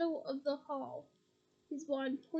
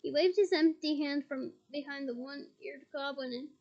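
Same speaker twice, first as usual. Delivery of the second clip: usual speaking voice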